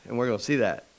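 usual speaking voice

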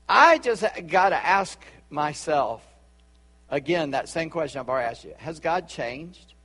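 ordinary talk, nothing marked

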